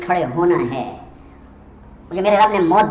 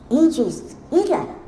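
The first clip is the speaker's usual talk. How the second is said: stunned; 7 semitones higher, 3 dB quieter than usual. Retold or imitated. Rumi asks, stunned.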